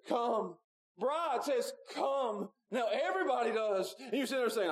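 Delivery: high, impassioned pleading sermon voice; full-force preaching. Acted. Preacher urging the whole congregation to come, impassioned. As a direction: plead with the sinners, voice soaring high.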